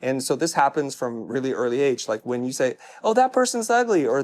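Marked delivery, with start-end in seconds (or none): 3.0-4.0 mock child blurt. Child blurting about someone's looks.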